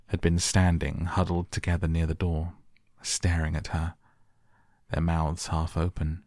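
plain reading voice

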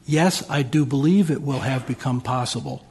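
ordinary talk, nothing marked

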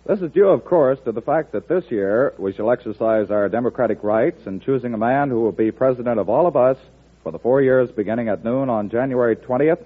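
ordinary talk, nothing marked

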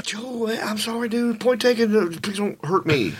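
high, meek pleading; retold scared begging; mock frightened apology. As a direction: placate the raging roommate in a small high voice. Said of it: "I'm scared".

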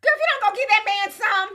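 ordinary talk, nothing marked

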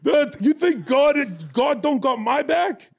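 loud, high, drawn-out gloating; triumphant shouting. Retold or imitated. Guest gloating after police lock her up, triumphant shouting. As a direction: crow it at full voice, stretching the words. Triumphant climax of arrest story.

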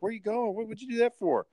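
very high whining child impression; frustrated kid. Kid locked out of the room by players, frustrated. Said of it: "listening to the kid get frustrated".

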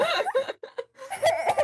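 high giggle bursting out; burst of laughter. Real, not acted. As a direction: laugh it off, amused. Laughing at awkward moment.

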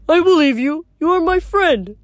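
high-pitched, simple big-guy voice; trusting Tyson. Acted. Tyson, the one-eyed big guy, answers.

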